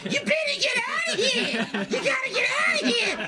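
put-on high cackling old codger yelling; toothless old man. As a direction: shout like a crazy old geezer. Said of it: "an old toothless man comes up and goes".

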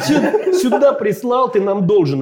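high, laughing gopnik demand; street thug voice. Retold or imitated. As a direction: act a pushy street thug, half-laughing. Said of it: "мы как бы как гопники, такие".

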